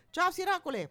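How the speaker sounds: high, loud greeting; cheery call-out. Real, not acted. Guest greeting her sisters on radio, loud cheery call. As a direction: call out hello to distant listeners.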